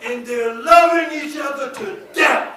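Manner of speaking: high, loud climactic cry; full-force preaching. Acted. Preacher condemns the couple's deadly love, crying out forcefully.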